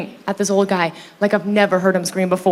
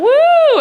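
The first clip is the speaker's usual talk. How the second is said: high, loud whoop; mock party cheer; 6 dB louder than usual. Comic announces her bowel syndrome, mock cheering. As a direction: whoop as if rallying a crowd.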